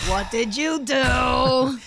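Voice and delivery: loud, very slow, stretched-out question; drawn-out mock scolding. Co-host pressing friend about Twitter trouble, drawn-out mock scolding. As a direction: stretch every word into a playful accusation.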